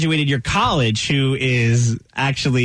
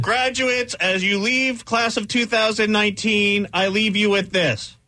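higher, grand, slowing to a shout at the end; pompous speaker; 7 semitones higher than usual. Pretend. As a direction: build a fake profound finale. Mock commencement ending.

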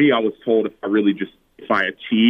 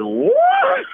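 high, stunned "What?" with a laugh; disbelieving yelp at the news; a squeaky incredulous what; shocked; 4 dB louder than usual. Retold or imitated. Learns he was not drafted.

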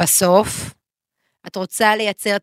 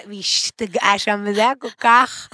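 normal talking voice

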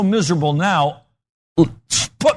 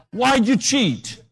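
slow demanding teacher's question, half-shouted; the teacher grilling the boy about cheating; stern teacher; 7 semitones higher, slower than usual. Retold or imitated. Teacher confronts boy, retold.

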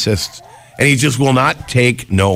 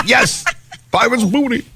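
excited; 11 semitones higher than usual. Real, not acted. Excited about snack.

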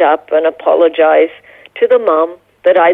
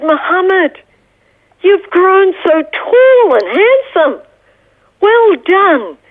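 very high, slow, gushing admiration; delighted recognition; 12 semitones higher, slower than usual. Retold or imitated. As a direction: act out greeting a child now grown up, voice soaring. Retold reunion with grown-up neighbour.

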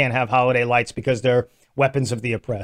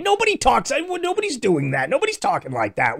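incredulous; 8 semitones higher than usual. Real, not acted. Mocking the quoted voice.

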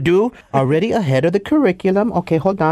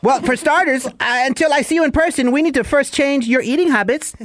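high-pitched barking sergeant; drill sergeant; 7 semitones higher than usual. Acted. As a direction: bark the new diet rules. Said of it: "Drill Sergeant Nacho Chavez".

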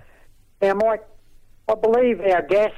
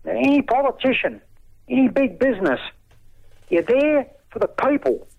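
raised, impassioned pitch; indignant rant; 3 semitones higher than usual. Real, not acted.

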